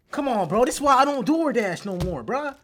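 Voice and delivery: ordinary talk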